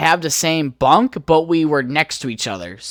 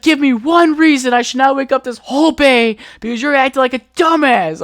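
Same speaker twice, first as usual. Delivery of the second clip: higher, louder angry threat; furious drill sergeant; 9 semitones higher, 5 dB louder than usual. Retold or imitated. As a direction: chew the recruit out. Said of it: "He's like".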